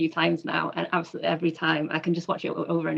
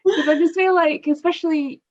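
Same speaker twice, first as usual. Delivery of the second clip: laughing; 8 semitones higher, 7 dB louder than usual. Real, not acted. Still laughing.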